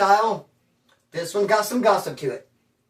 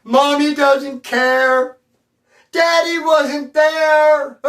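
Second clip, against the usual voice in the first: slow, higher, louder fake sobbing; mock crying and whining; 7 semitones higher, 6 dB louder, much slower than usual. Coming down off meth, hammed-up crying whine. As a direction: whine it out through mock tears. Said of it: "you'd be crying and whining".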